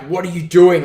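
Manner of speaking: acted yell of protest; retold yelling. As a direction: imitate an indignant shout back. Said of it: "he's yelling at him".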